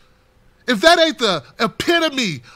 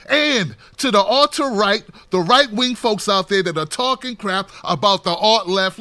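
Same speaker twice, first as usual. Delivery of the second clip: ordinary talk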